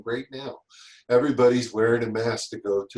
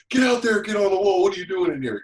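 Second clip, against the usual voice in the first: higher, louder barked orders; foreman screaming; 9 semitones higher, 5 dB louder than usual. Retold or imitated. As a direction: yell him out of the trailer. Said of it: "my foreman screamed at him".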